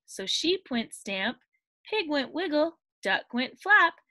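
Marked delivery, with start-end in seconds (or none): none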